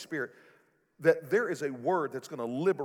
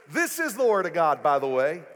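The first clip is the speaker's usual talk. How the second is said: preaching high and loud, building to a shout; full-force preaching; 7 semitones higher, 8 dB louder than usual. Acted. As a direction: lift the room with a rising proclamation.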